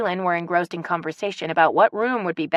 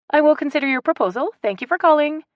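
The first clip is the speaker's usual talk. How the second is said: fast, higher formal phone sign-off; politely; 10 semitones higher, 4 dB louder, faster than usual. A narrator's reading. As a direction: end the call with mock formality. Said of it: "Haley said politely".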